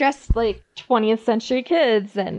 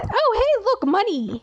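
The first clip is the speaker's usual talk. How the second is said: much higher, gleeful mock surprise; mock greedy villager; 9 semitones higher than usual. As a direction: spot the coins and pounce, pitched way up.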